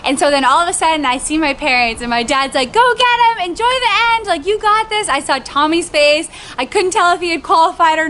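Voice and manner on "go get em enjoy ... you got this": high, excited cheer; imitated cheering dad. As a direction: cheer a runner on as dad would.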